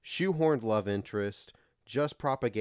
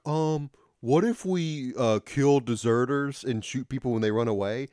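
mock officer; 3 semitones higher, 4 dB louder than usual. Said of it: "one guy's like".

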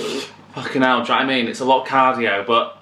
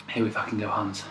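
out of breath; 5 semitones lower, 10 dB quieter, faster than usual. Real, not acted. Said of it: "I'm out of breath".

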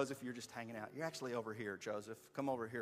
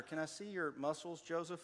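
ordinary talk

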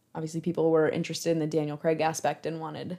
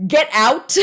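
loud imitated command, pitched up; raised voice; 6 semitones higher, 9 dB louder than usual. Actor ordering sponsor staff out of the house, imitated shout. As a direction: boom it out as a mock order. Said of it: "raises his voice to say".

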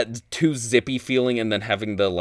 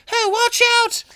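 high, loud mock Aussie shout; hammed-up Australian holler, pitched way up; over-the-top Australian; 6 dB louder than usual. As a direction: call out in an exaggerated cartoon Aussie accent. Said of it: "overly ironic Australian".

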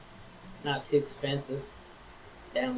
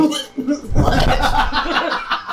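high burst of laughter; loud laugh; 10 semitones higher, 5 dB louder than usual. Real, not acted. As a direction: crack up out loud. Laughing at crude joke.

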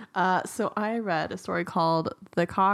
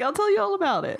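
high-pitched persuasive fox; coaxing fox; 11 semitones higher than usual. Acted. Fox promises rooster news of peace, high coaxing voice. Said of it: "he's like".

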